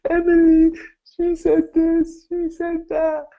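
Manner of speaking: put-on high, loud child's voice telling on a friend; little girl voice. The girl complains to the coach about her friend in a high child's voice. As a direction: pipe up like a tearful little girl.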